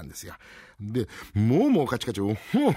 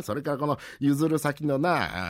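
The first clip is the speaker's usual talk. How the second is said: normal talking voice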